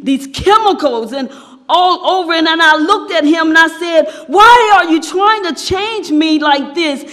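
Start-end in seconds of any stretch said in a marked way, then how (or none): 4.3-7.0 high, insistent acted outcry; raised-voice protest. Teacup objects to being painted by the master, raised-voice protest. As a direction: build to a loud demand to stop.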